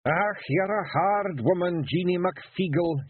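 slow, high Scots grumble giving in; husband giving in to his wife; grudging Feegle. A narrator's reading. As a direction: concede with a sigh, wee warrior. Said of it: "he said at last".

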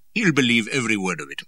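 a touch of cheerful teasing. A narrator's reading. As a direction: toss it off cheerfully. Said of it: "said the stout man cheerfully".

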